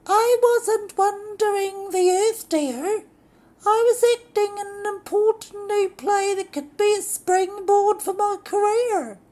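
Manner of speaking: high, loud falsetto lady voice; indignant diva. Acted. Lorraine defending her acting career to her husband. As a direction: protest in a hammed-up haughty shriek.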